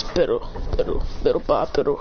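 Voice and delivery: low whispery creature gibberish. Acted. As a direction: make it sound like a strange creature muttering. Character babbles in odd voice.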